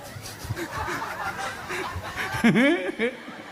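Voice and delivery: high, long laughter trailing off; hearty laugh. Real, not acted. Preacher laughing after teasing the man's loose mouth, high peals. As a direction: let the laugh ride high after the punchline.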